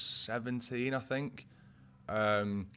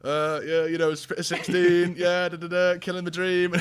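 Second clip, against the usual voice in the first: mock hype, laughing; 7 semitones higher, 8 dB louder than usual. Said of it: "and I'm like".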